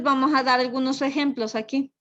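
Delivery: ordinary talk, nothing marked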